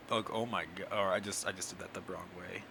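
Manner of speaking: ordinary talk, nothing marked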